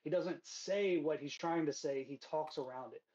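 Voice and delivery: ordinary talk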